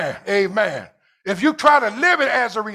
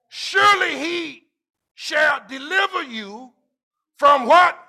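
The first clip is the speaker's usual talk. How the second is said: preaching scripture with call and response; 5 semitones higher than usual. Acted. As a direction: throw the line out and wait for them.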